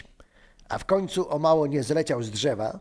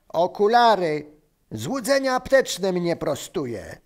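loud, higher-pitched mocking exclamation; rozbawiony wrzask; 4 semitones higher, 4 dB louder than usual. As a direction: yell it, cracking up. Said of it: "Wrzasnął, trzymając się za brzuch". (głośny, kpiący okrzyk)

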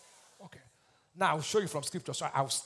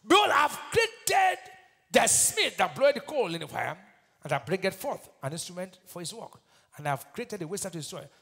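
fiery preaching; 6 dB louder than usual. Acted. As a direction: thunder out the opening word. Preaching scripture at full force.

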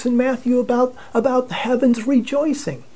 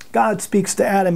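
ordinary talk

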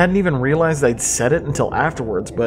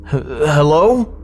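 mock nervous stammer; 3 semitones higher, 3 dB louder, much slower than usual. Mocking a nervous hello.

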